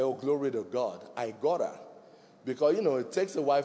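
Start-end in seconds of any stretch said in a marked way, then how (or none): 0.0-1.8 hushed aside. Retold or imitated. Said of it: "I said".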